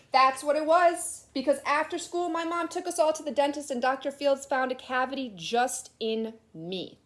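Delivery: put-on lively read-aloud to children; storybook reading. A narrator's reading. Teacher reading a picture book aloud to her class, animated. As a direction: tell it like a kid's picture book.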